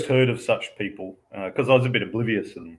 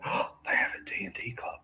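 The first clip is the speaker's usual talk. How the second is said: mock excited son; 10 dB quieter than usual. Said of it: "enthusiastically told me".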